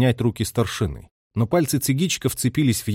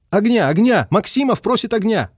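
high, loud radio cries; signalman's urgent, high-pitched appeal; nonstop repeated plea; 10 semitones higher, 4 dB louder than usual. A narrator's reading. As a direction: repeat the call for fire relentlessly. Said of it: "радист безостановочно повторял".

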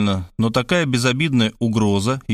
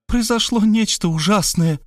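high tearful wail; tearful cry; 10 semitones higher than usual. Acted. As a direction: wail it through tears. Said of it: "заливаясь слезами".

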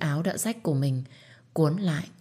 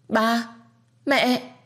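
put-on child's high, loud cry of shock; horrified cry; 8 semitones higher, 3 dB louder than usual. Boy sees his parents hanging, cries out high and loud. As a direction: cry out in horror. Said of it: "òa lên khóc nức nở".

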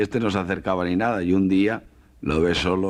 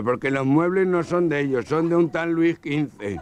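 a light nasal, higher joke voice; nasal-voiced man; 7 semitones higher than usual. Nasal guest tells his wife, lightly voiced. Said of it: "Un gangoso".

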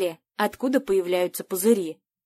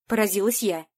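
ordinary delivery

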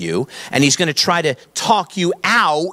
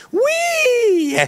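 very high, long imitated child's whee; gleeful squeal. Daughter as the coaster comes down, mock gleeful squeal. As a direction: squeal it way up high, like a thrilled kid. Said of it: "then you hear the word".

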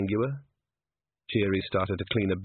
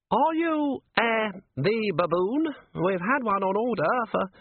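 much higher, halting, superior voice; haughty herald; 11 semitones higher, slower than usual. A narrator's reading. Herald inspecting Nobby through the grille, haughty. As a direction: look down on a commoner, high-pitched. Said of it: "aware there were degrees of nobility".

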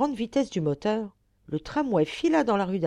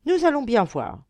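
higher-pitched determined declaration; resolute exclamation; 6 semitones higher, 4 dB louder than usual. Acted. Count heads out brandishing his umbrella, resolute. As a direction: declare it firmly, voice raised. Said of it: "s'écria résolument monsieur Juste le Comte".

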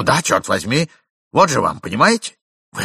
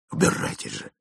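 whisper; 12 semitones lower, 5 dB quieter than usual. Acted. Whispered rebuke in dark.

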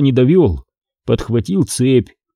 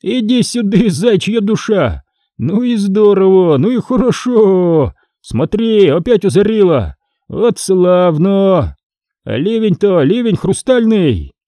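excited calling out; 8 semitones higher than usual. Acted. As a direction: call him over to admire the storm. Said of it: "крикнул". (восторженные выкрики, высоко и протяжно)

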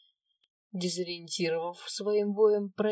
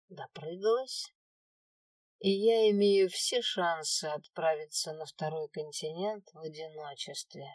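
ordinary delivery, nothing marked

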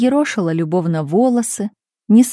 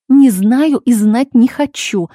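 slow, louder, higher-pitched grumble; grumpy snapped reply; 4 semitones higher, 6 dB louder, slower than usual. A narrator's reading. Mother refusing to discuss the father, grumpily. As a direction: grumble it slowly and forcefully. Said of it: "буркнула мать".

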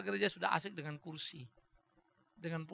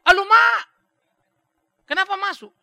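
shocked cry; 14 semitones higher, 19 dB louder than usual. Retold or imitated. Shocked cry at arrest news.

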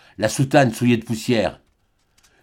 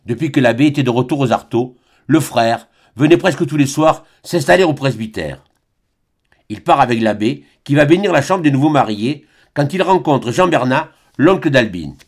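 usual speaking voice

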